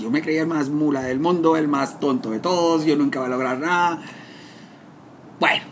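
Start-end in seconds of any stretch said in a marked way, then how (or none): none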